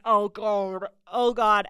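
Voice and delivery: loud groaning "oh God"; loud, dreading it. Retold or imitated. Dread at weird stranger.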